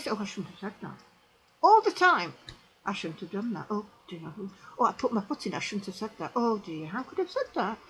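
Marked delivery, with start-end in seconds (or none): 0.1-1.0 soft, lower, sheepish murmur; quiet guilty sigh of words; rueful self-reproach. Retold or imitated. Voicing our own regret. 2.9-7.8 soft, lower, sheepish murmur; quiet guilty sigh of words; rueful self-reproach. Retold or imitated. Voicing inner regret.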